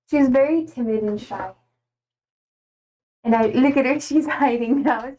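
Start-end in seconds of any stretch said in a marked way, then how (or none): none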